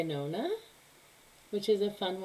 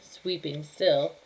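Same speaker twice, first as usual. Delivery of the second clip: plain reading voice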